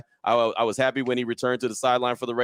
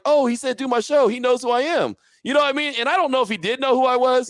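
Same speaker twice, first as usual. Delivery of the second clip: much higher, giddy starstruck voice; 1 thrilled; 12 semitones higher, 3 dB louder than usual. Retold or imitated. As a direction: bubble over with disbelief. Excited inner thought retold.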